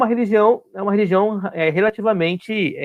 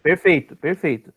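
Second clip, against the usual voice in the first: ordinary talk, nothing marked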